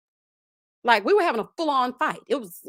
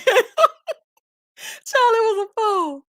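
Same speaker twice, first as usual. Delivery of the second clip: laughing; 10 semitones higher, 5 dB louder, slower than usual. Real, not acted. Laughing wrap-up.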